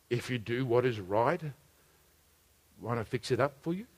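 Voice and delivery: hushed, low, soft warning; quiet voice of God. Retold or imitated. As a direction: speak gently to a troubled son. God speaks to Cain.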